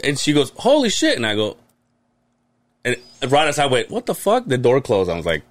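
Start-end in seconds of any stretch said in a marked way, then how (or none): 0.6-1.2 high-pitched retold shock; startled exclamation. Startled by sudden four-foot flame. 3.9-4.5 high-pitched retold alarm; shocked exclamation. Reacting to the flare-up.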